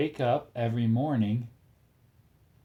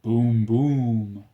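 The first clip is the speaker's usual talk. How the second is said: mock boom; 6 dB louder than usual. Tank sound in kids' book.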